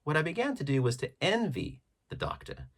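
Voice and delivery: ordinary delivery, nothing marked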